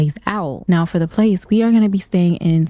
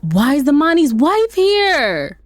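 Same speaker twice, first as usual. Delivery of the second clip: higher, slowed disbelief; alarmed; 6 semitones higher, slower than usual. Real, not acted. Gamer panicking at the wife, alarmed. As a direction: stretch out the shock.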